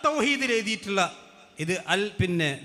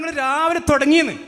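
higher, louder imitated snap; mock retort; 6 semitones higher, 6 dB louder than usual. Preacher imitates opponents brushing him off, mock retort. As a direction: toss back the opponents' excuse mockingly. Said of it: "അതിന് പറയും".

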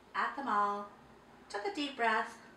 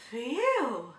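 1 relief; 3 semitones higher, 7 dB louder than usual. A narrator's reading.

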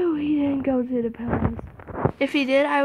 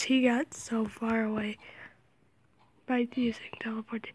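whispering; 2 semitones lower, 7 dB quieter than usual. Real, not acted. Whispering while hiding.

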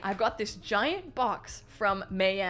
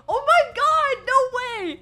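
loud, high shriek of delighted disbelief; thrilled shriek; 12 semitones higher, 11 dB louder, slower than usual. Real, not acted. Fan reading what is in the parcel, shrieking with excitement. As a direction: shriek at an unbelievable gift. Surprised by fan's gift.

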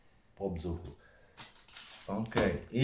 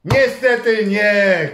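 loud, slow letdown; mock disappointment; 7 semitones higher, 9 dB louder, much slower than usual. Parent revealing a miss to viewers, hammed-up letdown. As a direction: stretch out the bad news.

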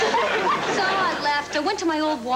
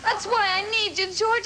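usual speaking voice

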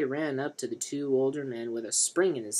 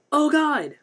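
very high, loud cry of pain; pained scream; 13 dB louder than usual. A narrator's reading. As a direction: yell it out as if hit hard. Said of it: "Mike screamed in pain".

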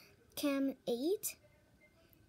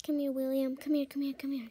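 loud taunting call; loud mock summons; 8 dB louder than usual. Real, not acted. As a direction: call him over, loud and teasing. Calling game character mockingly.

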